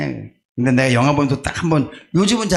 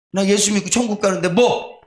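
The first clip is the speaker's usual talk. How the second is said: ordinary talk, nothing marked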